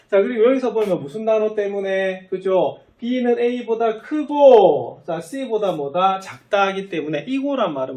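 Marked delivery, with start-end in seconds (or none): 3.0-5.0 크고 drawn out high and loud; deliberate read-out. Shown as a demonstration. Teacher explaining the word that joins two conditions, deliberate read-out. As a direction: read slowly and emphatically, leaning hard on 크고. Teacher repeats the demonstrated reading.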